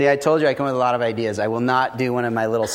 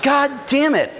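high, slow, startled realization; mock realization. Recalling realizing why he hates the phone, high startled voice. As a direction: hit the sudden insight like a shock.

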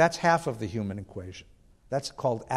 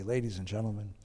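put-on hushed, breathless whisper with a European accent, much softer; 6 semitones lower, 10 dB quieter than usual. A lecturer imitates Heschel opening his lectures in a breathless whisper. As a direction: lean in and breathe the words like an old European sage. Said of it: "in a breathless voice with a European accent".

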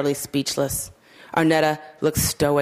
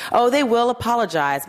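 higher, pointed anger; angry; 7 semitones higher than usual. A narrator's reading. Miss Margolin to the other leader, angrily. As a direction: let the anger aim past the listener. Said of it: "she said it so angrily".

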